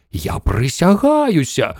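high, drawn-out protest; exclaiming. A narrator's reading. Shuckworth insists to Mission Control that he saw it, exclaiming. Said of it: "вигукнув бідолашний Шакворт".

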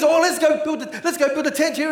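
eager, high-pitched mock enthusiasm; excited Peter. Preacher voicing Peter eager to build a tent, excited. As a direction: act out an excited disciple with a bright idea. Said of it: "And he's all excited".

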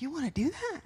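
father asking his daughter in disbelief; incredulous. Retold or imitated. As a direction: ask it stunned and high. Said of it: "I would be like".

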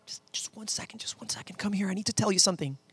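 urgent whispered interruption; whispering Martha. Acted. Martha calling Jesus aside mid-teaching, urgent whisper. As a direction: whisper insistently to pull him aside. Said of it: "And Martha being like".

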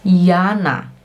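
Jana pronounced "Yana". Shown as a demonstration. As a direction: start "Jana" with a German Y glide. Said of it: "I, que é o J". (Jana com som de I, "Iana")

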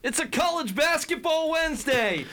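high, hyped-up announcer call; hype intro shout. Acted. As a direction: shout it like a show opener.

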